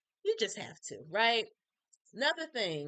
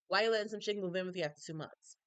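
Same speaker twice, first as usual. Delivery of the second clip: normal talking voice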